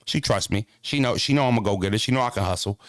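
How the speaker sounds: usual speaking voice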